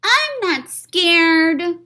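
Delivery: put-on slow, high, defiant child voice; stubborn high little kid; brave little child. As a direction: insist bravely, slowly.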